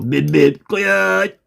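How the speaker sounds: beeps jumping high on the last word; high-pitched gag catchphrase; comic bit. Shown as a demonstration. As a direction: do a silly cartoon roadrunner beep. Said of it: "un petit truc comique".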